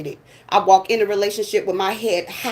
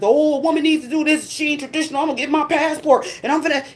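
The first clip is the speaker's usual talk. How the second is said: higher mocking voice of men; mocking men's complaints, pitched up; mocking men's nonsense; 4 semitones higher than usual. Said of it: "all of this nonsense".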